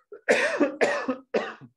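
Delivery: hoarse hacking cough; coughing fit. Real, not acted. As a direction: cough to clear a failing voice. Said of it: "I'm losing my voice".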